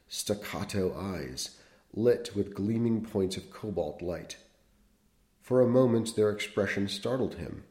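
measured literary read-aloud; unhurried storyteller voice; story narration. Acted.

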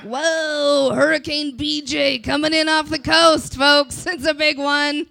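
over-the-top forecaster hype; mock weather alert. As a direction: shout it like a TV weather warning.

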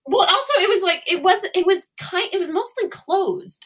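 high, loud, emphatic protest; insistent self-defence. Real, not acted. Defending herself as co-host laughs.

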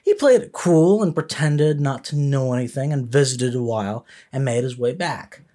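usual speaking voice

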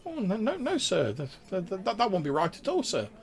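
very high, stammering sprite; Grimwald, squeaky. Acted. Nervous sprite corrects a lordly guest, squeaky and hesitant. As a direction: squeak out a nervous, deferential denial. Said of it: "in, like, a really, like, squeaky, high-pitched voice".

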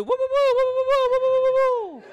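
high, loud whooping siren noise; mimicked siren whoops; imitated police siren. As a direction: wail woo-woo like a cop car. Imitates police siren after passing exit.

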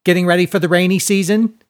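high, loud, quick jeer; leering taunt. A narrator's reading. Gap-toothed boy mocks Seinen's short pants, jeering. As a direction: rattle off the mockery, high and loud. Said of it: "leering at Seinen's too short pants".

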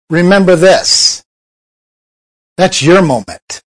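ordinary talk, nothing marked

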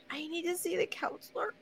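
high, soft mock-distressed student; fragile student. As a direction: put on a tiny, wounded student voice. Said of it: "you have to go in like them".

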